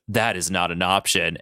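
low, cool villain refusal; Mister Sinister. Acted. Sinister refuses Logan, low and final. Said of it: "And Sinister goes".

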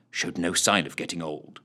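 ordinary delivery

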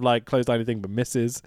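normal talking voice

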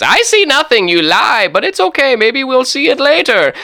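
put-on high-pitched minimum-effort foreign accent; hammed-up captain in a lazy put-on accent; Christopher Columbus. Captain back from looking dismisses the prisoner, high minimum-effort accent. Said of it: "minimum effort is what this accent".

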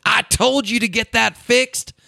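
high-pitched mock wife's scolding; slow, high "told-you-so" nagging; Lisa. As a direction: imitate a wife chiding, high and drawn out. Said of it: "And Lisa just".

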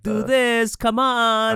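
high, loud coaxing like a photo-shoot clown handler; sing-song coaxing to get kids to smile; coaxing photographer. Retold or imitated. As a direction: coax the kids like a guy waving a clown puppet. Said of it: "the little clown puppet going like".

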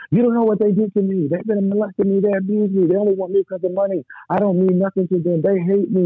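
high, pleading outburst at talking volume; retold distraught yelling. Hurt young man going off at the driver, reenacted distraught yelling. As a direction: pour it out in a sustained high, anguished rant. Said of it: "I'm just yelling at him".

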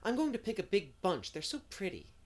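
high, eager exclamation; delighted. A narrator's reading. Diana wanting to pick a big bunch, delighted. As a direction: gush with lifted pitch.

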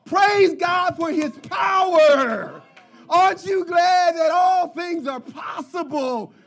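loud, high, slow proclamation of praise; preacher shouting praise to the church; preaching at full force. Acted. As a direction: build it up for the crowd. Preaching climax.

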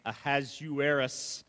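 Ahasuerus said slowly and deliberately; careful name. Shown as a demonstration. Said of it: "he's got a name".

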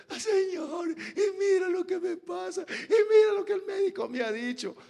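high, whiny, crying mock; whining plea. Churchgoer complaining to God about the doctor, whining. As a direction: mimic a believer moaning to God.

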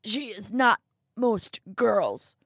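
slow, loud, emphatic correction; forceful elderly rebuke; indignant old man. Acted. Old man angrily corrects Jacobs.

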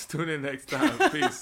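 laughing, squeaky high peace; laughing sign-off. Real, not acted. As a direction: sign off cracking up.

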